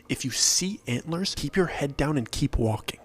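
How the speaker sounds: usual speaking voice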